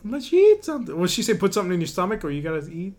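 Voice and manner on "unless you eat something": high squeaky put-on voice; high cartoon voice. Podcaster finishing the cartoon quote, high squeak.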